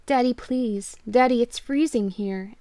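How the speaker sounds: put-on a cold, lost little girl begging; pleading child. Lost daughter begging her father for help.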